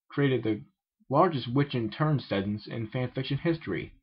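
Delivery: ordinary delivery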